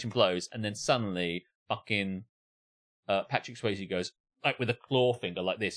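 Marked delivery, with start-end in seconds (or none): none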